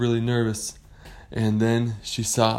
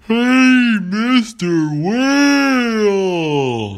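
high, very drawn-out holler; calling out; 12 semitones higher, 9 dB louder, much slower than usual. Acted. Pirate hailing a whale from the ship, long loud call. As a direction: call across the sea, stretching the words.

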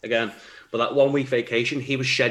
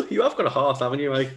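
laughing tease; 4 semitones higher than usual. Real, not acted. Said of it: "That is adorable".

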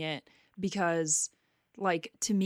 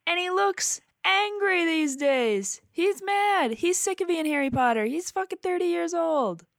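excited; 10 semitones higher, 3 dB louder than usual. Real, not acted. Excited rant.

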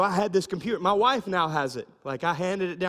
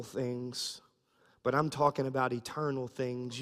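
gentle Jesus; 4 semitones lower than usual. Retold or imitated. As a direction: explain it kindly to Nicodemus, like a patient teacher. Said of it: "He just says".

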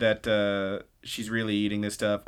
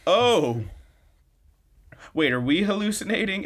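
high-pitched mock astonishment; freaking out; 9 semitones higher, 4 dB louder than usual. Friends see Hina floating in the air, acted freaking out. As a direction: draw out a startled, disbelieving high voice. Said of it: "they're, like, freaking out now".

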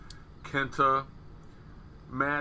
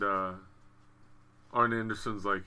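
normal talking voice

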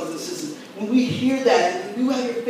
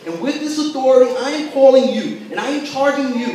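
louder, higher charge to the congregation; preaching at full force; 4 semitones higher, 6 dB louder than usual. Acted. Pastor charging the church with the mission, loud and higher. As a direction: drive it home like a call to arms.

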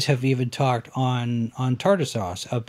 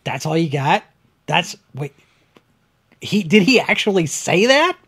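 incredulous; 5 semitones higher, 5 dB louder than usual. Retold or imitated. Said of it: "everybody sitting there ... going".

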